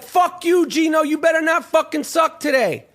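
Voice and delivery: high, taunting stadium jeer; a rowdy fan cursing out the quarterback; mock heckling fan. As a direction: heckle him like a fan in the stands. Imitating jeering New York fans.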